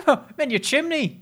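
silly chimney sweep. Pretend.

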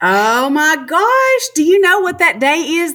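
high, loud, stunned exclamation; surprised. Real, not acted. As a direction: gasp it out in shock. Surprise at the date.

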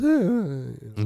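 slow stuttering voice cracks; a slow, halting imitation of a voice cracking; mock voice crack. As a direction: crack the voice on each uh. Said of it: "You were talking like this".

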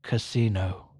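low, solemn one-word command; voice of God. Acted. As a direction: intone it low like a heavenly voice. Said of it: "he hears a voice".

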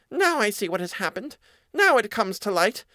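high, dawning realization as a mouse; squeaky mouse putting it together. A narrator's reading. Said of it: "said the mouse".

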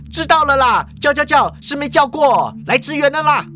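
high, exasperated cashier; fed up at being called, pitched well up; irritated grumbling. Acted.

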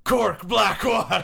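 high, loud comic character voice; silly squeaky joke voice; Cork Blackwater. Pretend. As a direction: pitch it way up and announce it through laughter. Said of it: "she sounds like this".

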